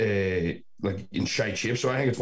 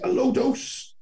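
very high-pitched, questioning disbelief; incredulous echo; much slower than usual. Retold or imitated. Echoing the claim in disbelief.

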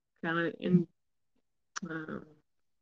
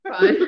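a high, loud whack sound effect; "whack" popped out high and loud like a cartoon hit; 14 semitones higher, 7 dB louder than usual. Telling how the guys got quills, a loud high whack.

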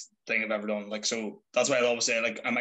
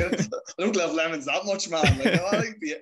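higher, half-laughing complaint; laughing protest; 6 semitones higher than usual. Retold or imitated. Son protesting box of lemons birthday present, laughing higher. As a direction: laugh through the grumble.

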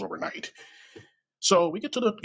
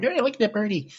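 put-on high-pitched childlike wonder; hammed-up silly excitement, pitched way up; tipsy delight; 11 semitones higher than usual. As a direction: coo it like a tipsy passenger spotting something cute. Tipsy at the plane window.

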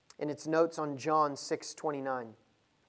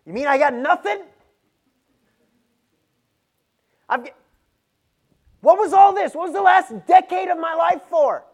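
retold protest, much higher and louder, ending in a shout; indignant outburst; 13 semitones higher, 16 dB louder than usual. Preacher replaying his own first reaction to grace, indignant shout. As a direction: cry out as if cheated of a whole decade. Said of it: "I didn't think it was good news".

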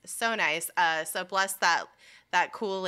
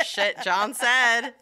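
louder and slower, laughing through it; laughing mock dread; 5 semitones higher, 9 dB louder, slower than usual. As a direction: laugh as you fake being scared of John. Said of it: "And I was like".

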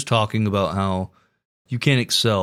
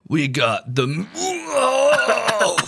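drawn-out, hammed-up slogan parody; Arby's ad voice; 9 semitones higher, much slower than usual. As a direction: stretch it out like an overblown commercial voiceover. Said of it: "Voice of Arby's".

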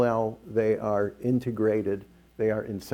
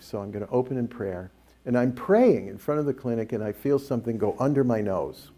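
ordinary talk, nothing marked

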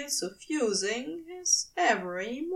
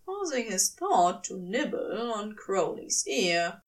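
ordinary delivery

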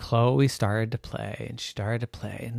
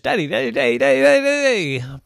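bubble echo; 10 semitones higher, 4 dB louder, much faster than usual. Acted. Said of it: "bouncing around the bubble".

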